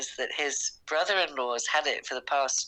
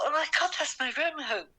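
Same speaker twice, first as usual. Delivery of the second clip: high, fast gasp of disbelief; can't believe her eyes, squeal it out; shocked disbelief; 12 semitones higher, faster than usual. Retold or imitated. Mum telling daughter she found her van, high shocked squeal.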